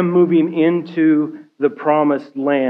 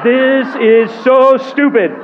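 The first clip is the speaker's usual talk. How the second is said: higher, louder, slower laughing gripe; exasperated laughing complaint; 7 semitones higher, 5 dB louder, slower than usual. Retold or imitated. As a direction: let the frustration burst out through a laugh. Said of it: "I would be marching around going".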